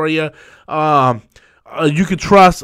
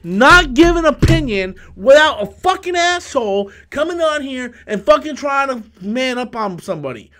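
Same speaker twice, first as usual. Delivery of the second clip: angry rant; 7 semitones higher than usual. Real, not acted. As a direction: yell down the chat troll who came at him. Ranting at hostile chatter.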